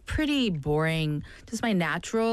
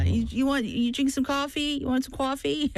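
higher-pitched New York coffee offer; New York accent; 7 semitones higher than usual. Shown as a demonstration. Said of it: "I love your New York accent".

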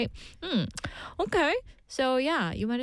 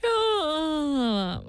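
fake yawn; 6 semitones higher than usual. Shown as a demonstration. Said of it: "I just fake a giant yawn".

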